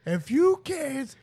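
slow, high, stern teacher voice. Pretend. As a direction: scold the kids like a principal.

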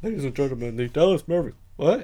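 imitating an arena announcer's intro; mock announcer voice. Podcaster introducing the Dallas Mavericks, hammed-up announcer voice. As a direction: put on a big game-night voice. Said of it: "it's like the announcer voice".

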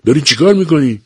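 high, loud cry of alarm; old man yells at his son in shock; alarmed shout. A narrator's reading. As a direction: shout at him to stop. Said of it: "پیرمرد فریاد زد".

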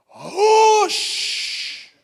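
high, booming movie-hero hush; theatrical command. Acted. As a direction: command the storm with a long, loud shush. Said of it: "we do things so theatrical".

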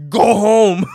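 loud laughing shout; loud, laughing mock yell; yelled from the window. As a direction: bark it out through a laugh. Yelling out the window.

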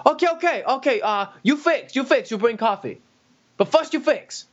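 high mock Chinese-accented English. Mandarin-speaking customer orders decaf, in a high mock Chinese accent. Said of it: "I was like".

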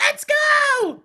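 put-on very high, loud child's hype yell; imitated little kid shout. Imitating a seven-year-old's voice memo, kid's shout. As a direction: shriek it like a hyped-up small kid. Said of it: "his kid just going".

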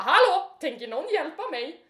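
loud, high indignant call; retold scream. Lifted woman calling to the guard standing by, indignant yell. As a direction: shout out for someone to step in. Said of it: "jag skriker".

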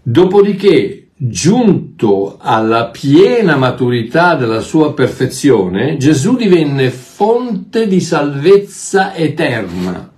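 slow, weighty reading of scripture; preacher asks for attention, then reads slowly and solemnly. Real, not acted. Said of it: "Adesso attenzione".

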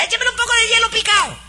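hammed-up hurried customer falsetto; mocking Nananina's voice, rushed. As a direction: rattle it off in a mock falsetto.

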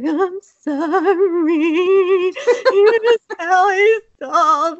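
high, slow, wailing sorry; mock tearful apology. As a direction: plead through imitated tears like a scared little girl. Child apologizing after hurting friend.

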